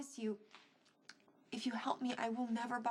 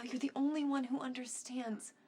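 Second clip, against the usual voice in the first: usual speaking voice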